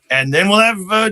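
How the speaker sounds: usual speaking voice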